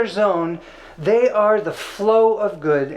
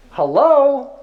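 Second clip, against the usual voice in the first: mock exasperated hello; 8 semitones higher, 7 dB louder than usual. Acted exasperation at nonbeliever.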